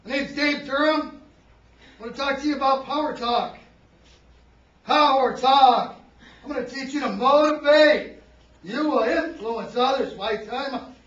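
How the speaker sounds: loud, slow, forceful stage speaker demo; overblown workshop pitch. Shown as a demonstration. Presenter showing the class a bad opening, loud and slow. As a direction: belt out a slogan-heavy speaker's introduction. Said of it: "if I come up front like this".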